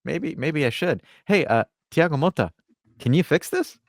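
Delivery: high-pitched mock-innocent questioning; Gentile. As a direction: play an unsure manager stalling for answers. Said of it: "And then Gentile is like".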